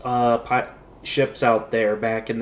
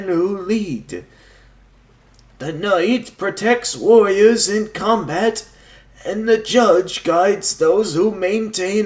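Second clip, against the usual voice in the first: put-on higher, slow old man voice; 5 semitones higher than usual. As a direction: speak like an ancient beach hermit. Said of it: "the old man voice".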